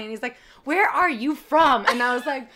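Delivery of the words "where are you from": slow, higher, louder mock demand; exasperated doorman. The doorman asking one more time, slow hammed-up demand. Doorman asks yet again.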